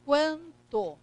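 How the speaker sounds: quanto split into two syllables; nasal vowel in quanto, syllables separated; quanto with silent N. Shown as a demonstration. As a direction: say quan, then to, no sounded N. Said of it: "eu não pronuncio".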